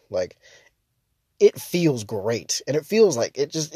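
ordinary talk, nothing marked

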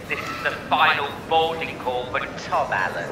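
repeated tannoy boarding call; airport announcement. Pretend. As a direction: call him again over the PA. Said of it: "I repeat".

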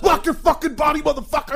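loud, high-pitched military command yell; going-off shout. Retold or imitated. Retired veteran going off on recruits, mock drill shout. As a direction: bellow the order at full tilt. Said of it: "I just start going off".

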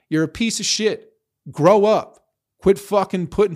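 angry outburst. Real, not acted. Telling off virtue-signalling critics, furious shout. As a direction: let it blow up at them. Ranting at critics who call him Trumper.

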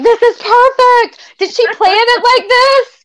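high, slow thrilled self-quote; excited inner voice. Retold or imitated. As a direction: squeal it like a delighted fan. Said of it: "and so I was like".

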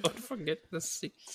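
high, slow, soft NPC impression; high mock voice. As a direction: stretch it out high and thin. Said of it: "mimicking the NPC line".

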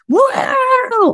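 high, drawn-out mock scream; imitating an over-the-top karaoke screamer. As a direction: wail like a karaoke singer screaming into the mic. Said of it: "screaming into the mic".

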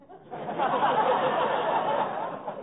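long rising unvoiced giggle; high giggle. Real, not acted. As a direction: giggle at his own gag. [name] laughs at own joke.

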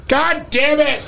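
high-pitched mock dad outburst; hammed-up parental fury; imitated angry dad. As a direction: bellow like a fed-up father. Imitating angry dad, heard from afar.

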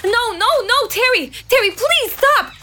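high, urgent pleading calls; anxious pleading. Acted. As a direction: beg him to stop, rising alarm. Lynn begs Terry to stop.

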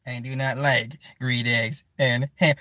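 low, slow storybook recital; drawn-out, deliberate Doctor Seuss inflection; Dr. Seuss read-aloud. Acted. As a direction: spell it out slowly like a children's book. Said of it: "just like that in that very same inflection".

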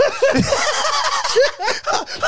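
long high laughing fit; real high-pitched burst of laughter; cracking up laughing. Real, not acted. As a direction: lose it laughing mid-story. Cracks up mid-story.

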